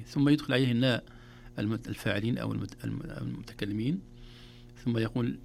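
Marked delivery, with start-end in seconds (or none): none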